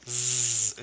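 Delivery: z held as a long buzz; Z sound. Shown as a demonstration. Teacher sounding out the word's end, buzzed z. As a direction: buzz a sustained z alone. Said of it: "sounded like a Z".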